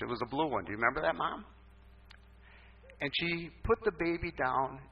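ordinary delivery

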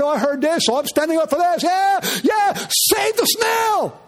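mock protester. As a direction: parody a protester shouting. Mocking a parroting protester.

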